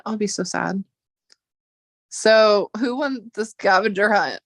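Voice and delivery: ordinary talk, nothing marked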